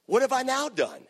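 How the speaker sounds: high, indignant "what have I done"; mock innocent David. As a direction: play David protesting his innocence. David mocking older brother.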